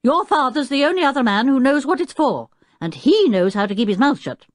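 high, loud, pointed warning; testy professor's stern telling-off; fierce. A narrator's reading. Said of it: "looking fiercely at George".

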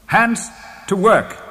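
high, loud command; rallying cry. A narrator's reading. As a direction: call everyone to work. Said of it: "cried my uncle".

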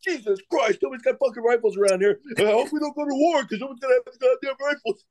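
high, hurried despairing rant; mock exasperation. Staff sergeant fretting about war without rifles, high mock exasperation.

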